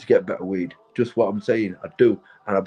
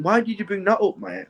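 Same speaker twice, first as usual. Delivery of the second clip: indignant surprise; 9 semitones higher than usual. Real, not acted. Reacting to a viewer's question.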